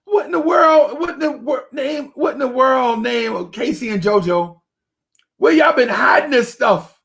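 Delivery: high mock-ecstatic gushing; amazed delight. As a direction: rave about the taste like a revelation. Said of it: "I'm like".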